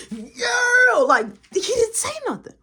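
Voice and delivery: high, loud, insistent protest; nearly yelling at the listener; loud, high, breaking protest; exaggerated shout. Real, not acted. Aside to the audience, exaggerated.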